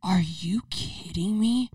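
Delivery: slow unvoiced whisper; whispered disbelief. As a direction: hiss it in stunned disbelief. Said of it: "he was like".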